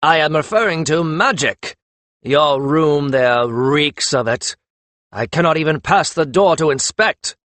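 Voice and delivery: exasperated, enraged Magus; snarling. A narrator's reading. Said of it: "the Magus snarled".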